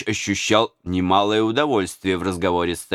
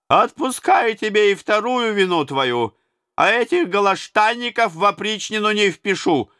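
high, lordly tsar handing out orders; imperious tsar; 12 semitones higher than usual. A narrator's reading. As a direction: rule the room as a mocking tsar.

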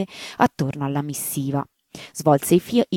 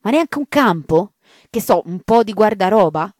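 dismayed secretary; 5 semitones higher, 5 dB louder than usual. Acted.

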